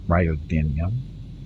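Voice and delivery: Ryodinium drawn out slowly, syllable by syllable; hesitant, slow try at Ryodinium; careful slow pronunciation. Shown as a demonstration. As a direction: sound out Ryodinium slowly and unsure. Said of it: "Is that how you say that?".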